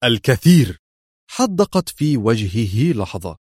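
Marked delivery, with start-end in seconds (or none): 0.0-0.8 firm one-word answer; restrained but decisive reply; stern, decisive. A narrator's reading. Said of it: "أجابها في صرامة حاسمة".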